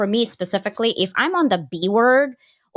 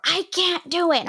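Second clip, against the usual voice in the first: higher, whiny "I can't do it" with stress on "can't"; mock discouraged employee; 9 semitones higher than usual. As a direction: play someone giving up.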